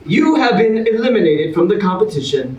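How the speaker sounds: big, bright host announcement; game show host. Acted. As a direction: sell it like a TV host to the audience. Host reveals and eliminates.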